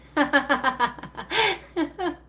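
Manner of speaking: bursts out laughing at the comment; real laugh at a joke; amused laugh. Real, not acted. Laughing at a viewer's squirrel joke, amused laugh.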